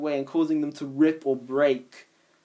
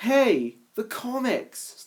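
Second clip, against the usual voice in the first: delighted; 8 semitones higher, much slower than usual. Acted. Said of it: "Snaptrap chuckled".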